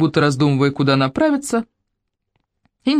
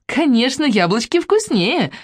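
put-on giggling girl; 9 semitones higher, slower than usual. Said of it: "засмеялась Варя".

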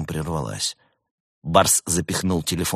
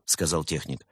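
ordinary delivery

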